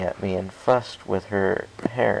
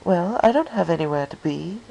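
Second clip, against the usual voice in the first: a mild purr, lightly higher; 10 semitones higher, 4 dB louder than usual. Acted. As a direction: purr it at him, inviting. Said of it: "Rarity purred".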